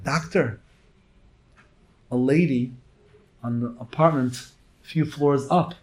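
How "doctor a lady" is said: usual speaking voice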